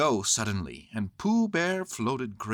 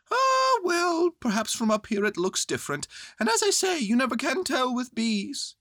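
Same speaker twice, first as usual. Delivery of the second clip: high, loud bear voice; calling philosophically from up high, as a bear; Winnie the Pooh; 5 dB louder than usual. Acted. Bear consoling himself up high, raised high voice.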